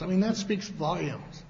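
ordinary delivery

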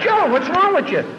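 high, loud taunting call; high loud teasing jab; mock exasperated challenge. Brian mocking Joe in retold exchange.

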